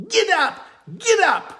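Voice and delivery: loud, high yells at a team; urgent high-pitched driving shout; repeated shouting. Acted. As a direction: shout the command over and over. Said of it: "Almanzo kept shouting".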